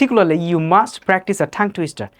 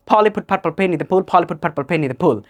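quicker, run-together repeats with popping p's; accelerated tongue twister; slower than usual. Shown as a demonstration. Teacher shows learners how to speed up, accelerated. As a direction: rattle it off twice without stopping between words. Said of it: "then accelerate".

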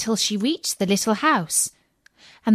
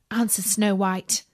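plain reading voice

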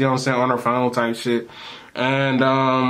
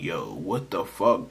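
low worried mutter; 7 semitones lower, 8 dB quieter, slower than usual. Retold or imitated. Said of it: "I'm scared as shit".

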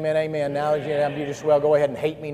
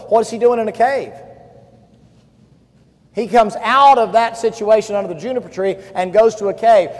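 loud, high, driving preacher voice; fiery pulpit delivery; full-force preaching; 8 semitones higher, 11 dB louder than usual. Acted. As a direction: push the story hard at the congregation.

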